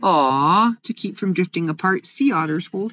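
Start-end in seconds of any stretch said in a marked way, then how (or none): none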